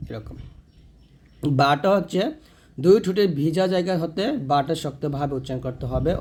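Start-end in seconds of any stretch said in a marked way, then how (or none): none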